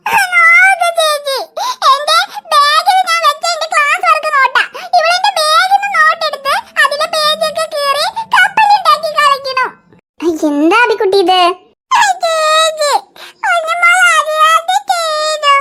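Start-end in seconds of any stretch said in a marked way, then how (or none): 0.1-9.7 put-on whiny crying child. Daughter wails to mother about torn notes, tearful and whiny. Child complaining to older sister. 12.2-15.5 put-on little child crying. Little girl sobs to big sister that she didn't mean it.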